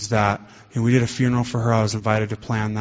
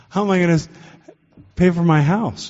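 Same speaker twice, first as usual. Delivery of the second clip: put-on higher and louder, a panicked drunk's plea; pleading drunk; 7 semitones higher, 3 dB louder than usual. Drunk pleading with God about his house, higher and louder. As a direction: beg as if losing everything.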